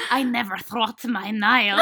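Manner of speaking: slow mock Russian through laughter; laughing, hammed-up Russian accent; thickest Russian accent. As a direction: imitate a failed Irish attempt that comes out Russian, slowly. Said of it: "gave the thickest Russian".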